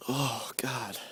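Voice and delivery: breathy whispered groan; whispering. Real, not acted. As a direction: breathe it out under your breath. Whispered in the cold.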